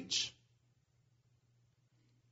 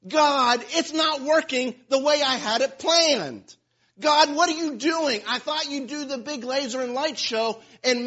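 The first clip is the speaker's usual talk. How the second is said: higher, louder whining at God; whiny complaint; 4 semitones higher, 5 dB louder than usual. Retold or imitated. Believer griping to God, whiny. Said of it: "How many times have we done that?".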